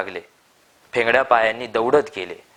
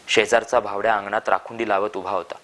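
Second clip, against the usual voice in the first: plain reading voice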